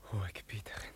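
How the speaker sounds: softly whispered address; tender whisper. Acted. Youth kneeling by the tiger whispers to the princess. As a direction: drop to a breath for her title.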